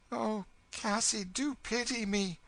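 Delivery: high, pitiful plea; pleading girl. Acted. Girl begging Cassie for sympathy, pitiful pleading. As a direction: beg for pity, pitched far up.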